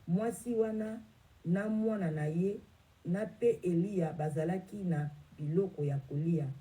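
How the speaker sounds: normal talking voice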